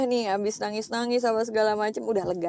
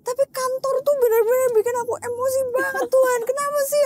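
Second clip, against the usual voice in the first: much higher, louder, tearful plea; tearful prayer; 13 semitones higher, 6 dB louder than usual. Retold or imitated. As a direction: cry it out to God. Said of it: "Aku nangis". (doa sambil menangis, nada jauh lebih tinggi dan keras)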